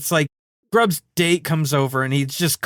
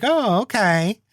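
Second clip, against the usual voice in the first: higher-pitched, drawn-out mimicked "oh, okay"; mock acquiescence; 7 semitones higher, slower than usual. Host voicing the woman won over by helpers, mock acquiescence. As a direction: imitate her giving in.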